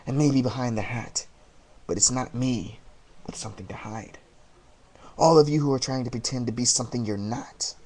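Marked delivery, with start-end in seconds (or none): 3.3-4.2 menacing whisper. Acted. Menacing promo line.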